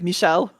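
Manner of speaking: "Michelle" said the French way, stress on the last syllable. Shown as a demonstration. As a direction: give "Michelle" a French lilt, ending on a rise. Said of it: "say it more French and be like".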